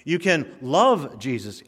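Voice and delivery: "love" drawn out and set apart; slow down and lean on "love" as if in quotation marks; air quotes on LOVE. Real, not acted. Preacher doubting insincere believers, slow air-quoted stress on "love". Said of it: "air quotes".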